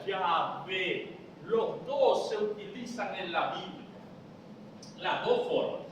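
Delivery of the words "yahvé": Yahvé sounded out syllable by syllable; demonstrated pronunciation. Shown as a demonstration. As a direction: say Yahvé as a careful teaching example. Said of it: "y se pronuncia".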